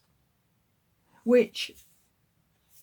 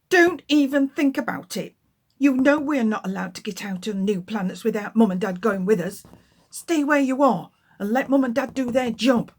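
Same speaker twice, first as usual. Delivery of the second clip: warning; 6 dB louder, faster than usual. Acted. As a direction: tell the little ones off sharply. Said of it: "warned Tula".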